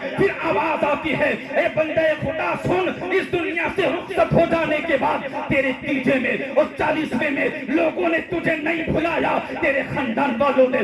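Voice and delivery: high, relentless rhetorical crescendo; full-force preaching. Acted. Unseen voice answering the dead man, preached at full pitch. Preaches climax in heavenly reply.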